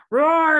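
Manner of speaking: loud, high mock roar; dragon's bellow. A narrator's reading. Dragon roaring at Boy, bellowing. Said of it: "bellowed the dragon".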